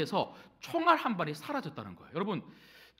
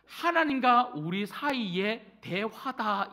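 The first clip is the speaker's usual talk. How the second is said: slow, louder definition; slow emphatic quote; 5 dB louder, much slower than usual. Shown as a demonstration. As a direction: lay out the saying slowly. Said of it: "기도를 가리켜". (천천히 또박또박 강조)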